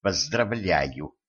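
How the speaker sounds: slow, soft, poisonous hiss; venomous hiss. A narrator's reading. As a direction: hiss the congratulation through spite. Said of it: "ядовито прошипела Катран".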